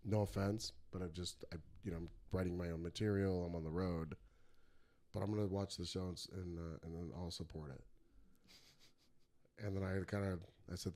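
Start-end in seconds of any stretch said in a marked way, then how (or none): 0.0-7.8 mock-polite, quiet and measured; polite, soft refusal. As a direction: play it humbly polite and soft. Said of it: "real politely".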